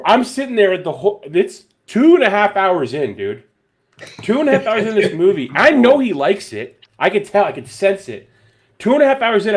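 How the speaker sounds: loud, high, insistent storytelling; worked-up exasperation. Real, not acted. Animated retelling of impatient wait.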